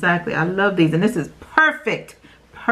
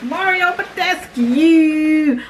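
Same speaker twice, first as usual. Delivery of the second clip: excited repeat; 8 semitones higher, much slower than usual. Real, not acted. Repeats brand name.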